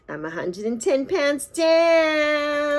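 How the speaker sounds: drawn-out, high, slow celebration; excited. Real, not acted. Vlogger to viewers, proudly announcing her weight loss, slow and high. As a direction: savor every word like a victory shout.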